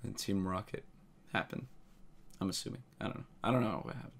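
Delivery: soft, low murmur under the trailer; hushed aside. Real, not acted. Streamer murmurs under the trailer narration, softly. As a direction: mutter to yourself while watching.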